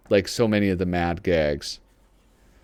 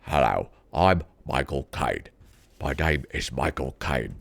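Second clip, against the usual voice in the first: low, clipped-free Cockney-tinged Caine voice, much lower; deep Michael Caine imitation; Michael Caine impression; 8 semitones lower than usual. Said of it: "Should I do a Michael Caine impression?".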